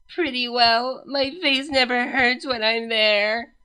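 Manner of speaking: slow, strained voice of a princess in pain; pained Cadance. A narrator's reading. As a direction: drag each word out through the hurt. Cadance's suffering voice.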